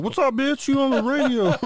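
high, loud, hammed-up party greeting; high-pitched mock shout down the phone; mock rowdy phone greeting. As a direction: yell it like a wild phone hello. Mocking a mom phone greeting.

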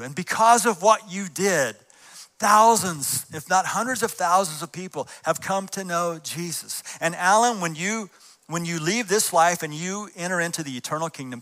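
fervent build to the dying man; rising fervor. Acted. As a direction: lift it toward heaven.

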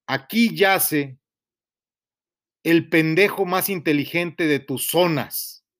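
slow, grave recital of a tombstone line; solemn epitaph reading. Acted. Reading his own epitaph aloud to Pradip, slow and solemn. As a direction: read it like words carved in marble. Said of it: "el epitafio dice así".

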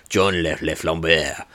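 slow, hammed-up French accent; worst French accent. Shown as a demonstration. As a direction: savour the name in a terrible French accent. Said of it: "doing the worst French accent possible".

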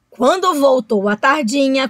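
ordinary delivery

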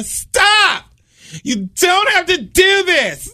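high wailed mock begging; desperate pleading. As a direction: beg the attacker to stop, slow and high.

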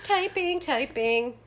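high, chirpy sing-song. Pretend. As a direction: chirp it like a cartoon. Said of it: "like a sound".

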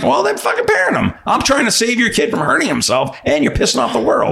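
worked-up, high-pitched scolding; exasperated rant. Acted. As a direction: pitch jumps high in indignation. Worked-up rant at bad parents.

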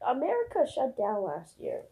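ordinary talk

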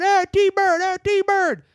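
high, loud shout after him; calling out. Retold or imitated. Imitating Skank yelling after T-Bird as he drives off. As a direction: yell after the car.

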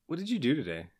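a hint of singsong asking; lightly voiced husband. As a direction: imitate a partner asking innocently. Voicing husband's question.

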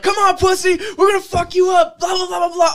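high, loud mock challenge; retold taunting yell. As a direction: yell like a gang calling someone out. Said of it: "we just start yelling".

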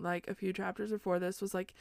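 ordinary talk, nothing marked

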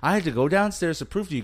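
ordinary talk, nothing marked

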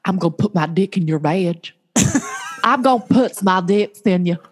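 lightly voiced film character, slightly low; film character impression. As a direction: lightly imitate a comic actor's awkward line. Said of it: "he's like".

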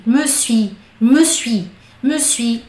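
me suis clipped fast, the e almost gone; quick me suis. Shown as a demonstration. Teacher drilling the quick me suis for students. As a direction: run me suis together quickly. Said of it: "é bem rapidinho".

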